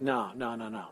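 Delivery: low, soft, mild brush-off; dismissive denial. Retold or imitated. Peter denying he is from Galilee, quietly dismissive. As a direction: deny it under your voice, trailing away. Peter denies knowing Jesus, retold.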